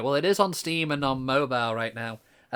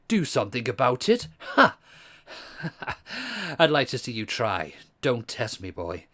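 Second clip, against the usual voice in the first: posh voice; 2 semitones lower than usual. Acted. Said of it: "so he's gonna stay with the posh".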